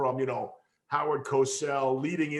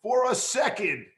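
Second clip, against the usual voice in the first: higher, emphatic pleading retort; mock exasperation; 5 semitones higher, 4 dB louder than usual. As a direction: tell them off playfully, pitched up. Acted retort to impatient colleagues.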